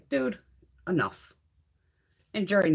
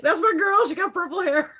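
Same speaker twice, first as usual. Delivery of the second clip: higher, louder playful cheer; excited cheering; 10 semitones higher, 5 dB louder than usual. Retold or imitated. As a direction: gleefully cheer her on, high-pitched. Excited quote cheering purple-haired congresswoman.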